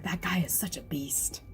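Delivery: soft, voiceless awe; awed whisper. Retold or imitated. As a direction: whisper it in amazement. Louis awed by Bomb.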